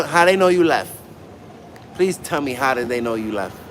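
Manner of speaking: normal talking voice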